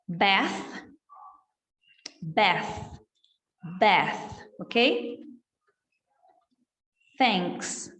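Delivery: each word slow with a clear th; th drill. Shown as a demonstration. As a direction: model the th for students to repeat. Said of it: "pra gente repetir". (repetição do th)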